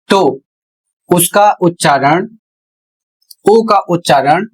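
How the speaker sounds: normal talking voice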